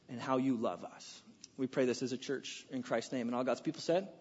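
bow your head and pray it softly; prayer. Acted. Pastor praying for the church this morning, hushed and sincere.

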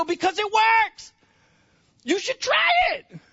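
high-pitched, passionate preacher; really excited preaching. Real, not acted. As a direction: build to an impassioned, high cry. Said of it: "I'm getting really excited".

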